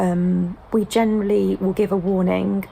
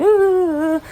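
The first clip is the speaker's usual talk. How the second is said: frustrated whine; 11 semitones higher, 6 dB louder than usual. Retold or imitated. Toddler frustrated, crying.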